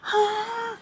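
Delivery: short high yelp of pain; a hammed-up pained yelp. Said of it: "She starts sc-, screaming".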